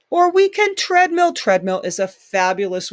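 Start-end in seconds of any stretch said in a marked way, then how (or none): 0.1-1.3 excited. Real, not acted. Teacher punching options.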